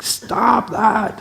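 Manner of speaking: high, loud imitated shout at a child; hammed-up yell at a crying kid; mock shout. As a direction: snap it like an impatient parent. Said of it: "doesn't help to shout at the kid".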